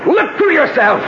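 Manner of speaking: very high, full shout; fierce battle shout. Acted. Lover lunges at armed husband, fierce shout. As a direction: yell it as you lunge.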